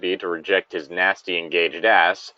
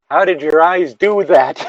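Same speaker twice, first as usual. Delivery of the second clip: mock shock; 7 semitones higher, 7 dB louder than usual. Shocked at her friend's new look.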